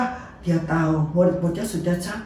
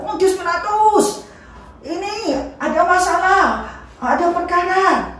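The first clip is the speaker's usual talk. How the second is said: much higher, shouting up to the governor; crowd calling out; 11 semitones higher than usual. Acted. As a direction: yell for the governor's attention.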